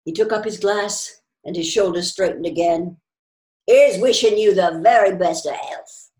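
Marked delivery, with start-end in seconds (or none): 3.7-6.1 put-on loud, cheery old man's toast; hearty toast. A narrator's reading. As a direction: raise the glass heartily. Old man raises a toast.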